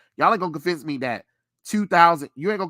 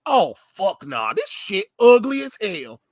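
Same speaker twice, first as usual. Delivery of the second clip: disgusted outburst; 2 semitones higher, 5 dB louder than usual. Retold or imitated. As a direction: reject the suit in disgust. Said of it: "and I said".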